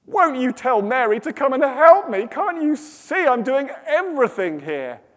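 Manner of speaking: high, exasperated plea; losing it. Acted. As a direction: wail indignantly that you do all the work. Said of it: "Martha loses her shizzle".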